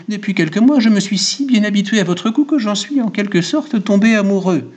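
high, pleading confession; flustered barber. A narrator's reading. Barber confesses attachment to his client, high flustered voice. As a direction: keep the pitch up, confessing helplessly. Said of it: "bégaya le barbier".

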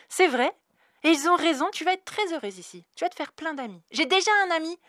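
put-on eager small girl; little girl. A narrator's reading.

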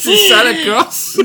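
slow, laughing disbelief. Real, not acted. Laughing in disbelief at her Corsican mother's curse. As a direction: laugh the question out slowly.

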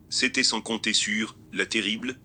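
ordinary delivery

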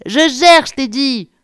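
loud, high, irritated insistence; scolding. A narrator's reading. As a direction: tell off a colleague at full voice. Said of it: "gronda-t-elle". (gronder avec agacement, fort et aigu)

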